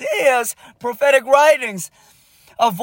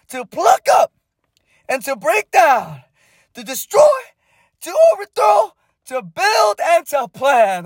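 rising preacher shout; 4 semitones higher than usual. Real, not acted. Climactic reading of Jeremiah's commission.

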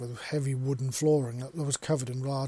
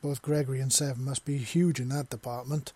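ordinary delivery

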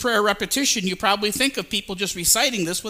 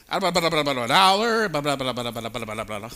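rattling auctioneer gabble, fading out; auctioneer patter; 4 semitones lower than usual. Acted. Preacher likens pagan prayer to an auction, auctioneer babble. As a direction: rattle nonsense like an auctioneer, one word popping out. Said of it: "It's like an auction".

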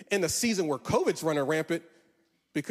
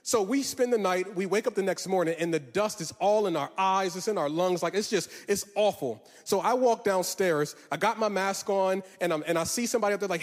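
normal talking voice

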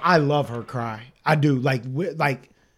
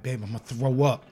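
queasy confession; 5 semitones lower, 5 dB quieter than usual. Retold or imitated. Retells steadying himself while nauseous.

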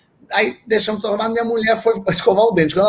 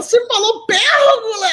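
shrill disbelief. Retold or imitated. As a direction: shriek it like she can't believe it. (esposa chapada incrédula, grito agudo)

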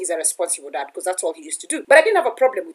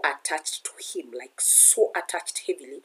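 soft whisper, much softer; whispered aside; 6 semitones lower, 10 dB quieter than usual. Real, not acted. Telling viewers about her daughter's attachment, whispering. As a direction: drop to a confiding whisper.

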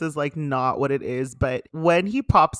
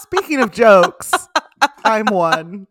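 laughing; 4 semitones higher, 6 dB louder than usual. Real, not acted. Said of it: "cohost echoes laughing".

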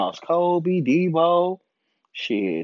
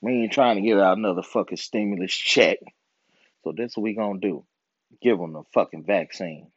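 mock government official; 7 semitones lower than usual. Comedian voices officials plotting the vaccine, low and scheming.

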